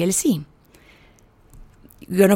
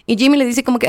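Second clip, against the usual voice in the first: ordinary delivery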